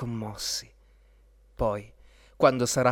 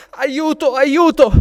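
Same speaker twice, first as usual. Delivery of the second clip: very high, loud maiden's cry; cry for help; 14 semitones higher, 14 dB louder, slower than usual. A narrator's reading. Captured princess calls for rescue, high loud cry. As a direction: scream out for rescue, high and urgent. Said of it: "gridò la fanciulla".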